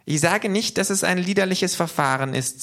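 very high, squeaky-pitched character voice, insistent; K, small creature voice. A narrator's reading. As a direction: play K high above your own voice. (sehr hohe Figurenstimme, eifrig)

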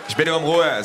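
loud, high, exasperated plea. Acted. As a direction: raise your voice to call for order. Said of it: "sagt der Mann".

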